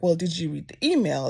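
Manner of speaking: ordinary delivery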